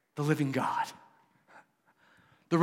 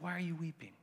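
much softer, tender "Why are you weeping?"; 2 semitones higher, 13 dB quieter, faster than usual. Shown as a demonstration. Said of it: "He's like".

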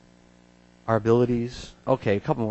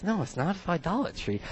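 softer, firm correction; quiet answer; 5 semitones higher, 8 dB quieter than usual. Real, not acted. Preacher quietly correcting the crowd, firm.

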